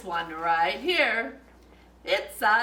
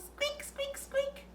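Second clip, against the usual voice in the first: tiny, much higher, soft squeaks; mouse squeak; 10 dB quieter, slower than usual. Pretend. Librarian voicing a little mouse for kids, high and soft. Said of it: "a little mouse".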